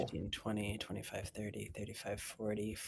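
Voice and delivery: very soft, low mutter to self; counting under breath. Real, not acted. Game master counting out movement, muttered under breath. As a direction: count off squares barely audibly.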